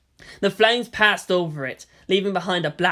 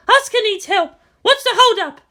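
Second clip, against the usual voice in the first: much higher, louder demand; urgent shout; 8 dB louder than usual. Acted. Officer demanding help for Asuka, urgent shout. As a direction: yell for help, impatient.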